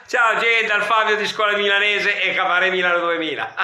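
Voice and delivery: loud, high showman farewell; announcer sign-off. Acted. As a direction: sign off like a cabaret host.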